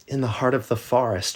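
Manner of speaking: put-on eager little girl answering.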